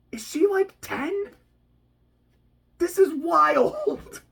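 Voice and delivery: high, disbelieving, drawn out; can't believe it; incredulous. Real, not acted. Vlogger stunned by the childish villain, incredulous.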